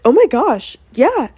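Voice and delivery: squealing at the autograph offer; excited. Retold or imitated. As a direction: burst out with delight.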